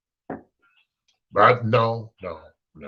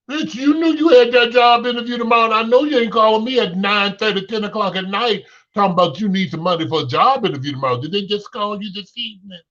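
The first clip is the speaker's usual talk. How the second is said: exasperated scolding; 8 semitones higher, faster than usual. Acted. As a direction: chew out the late-night borrower. Scolding a borrower calling late.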